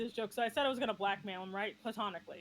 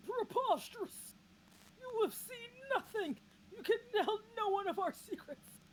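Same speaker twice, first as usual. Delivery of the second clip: put-on old man ghost; 10 semitones higher than usual. Streamer retelling a ghost encounter, mock old man voice. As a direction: declaim it like an affronted old ghost. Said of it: "old man, a ghost. And he's like".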